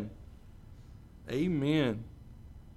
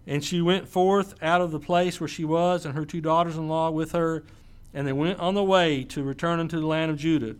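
steady, measured Bible reading; scripture reading; 4 dB louder than usual. Acted. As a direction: read the passage aloud to the church. Reads scripture aloud.

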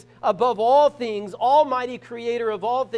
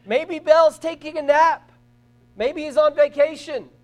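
loud, raised mocking call; sarcastic jeer, pitched up and projected; mocking taunt; 6 semitones higher, 9 dB louder than usual. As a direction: taunt them loudly, making fun of their god. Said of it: "he's mocking them as they're crying out".

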